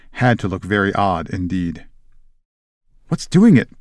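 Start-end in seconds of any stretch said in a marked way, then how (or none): 3.1-3.8 higher-pitched acted alarm; terrified. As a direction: read it as a frightened schoolgirl's demand. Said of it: "Parvati demanded in a terrified voice".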